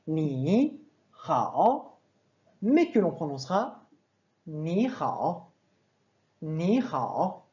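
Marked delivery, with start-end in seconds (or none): 0.1-1.9 "ni" and "hao" said apart, each a full dipping third tone; tons tels qu'écrits. Shown as a demonstration. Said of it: "qui s'écrit en pinyin". 4.5-7.4 "ni" only rises, "hao" dips low then rises; sandhi du troisième ton. Shown as a demonstration. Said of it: "je ne suis pas descendu, je suis juste monté".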